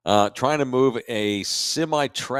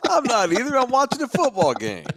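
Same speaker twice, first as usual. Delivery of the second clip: laughing; 4 dB louder than usual. Real, not acted. Laughs while denying.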